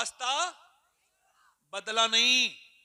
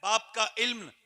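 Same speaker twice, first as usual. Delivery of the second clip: rising declamation, swelling much louder; reciting Iqbal's verse; 12 dB louder than usual. Acted. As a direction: declaim the couplet to the crowd, building. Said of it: "और इकबाल कहता है".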